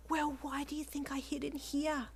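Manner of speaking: high, breathy timid voice; timid co-worker. A narrator's reading. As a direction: half-whisper it in a timid, high voice. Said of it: "the timid co-worker replied".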